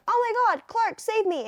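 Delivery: high mock cry for help; a hammed-up damsel; mock scream. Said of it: "she's like".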